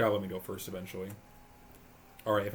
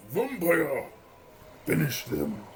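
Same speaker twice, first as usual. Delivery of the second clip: villain command; 6 semitones higher, 5 dB louder, slower than usual. Pretend. Commanding a card attack.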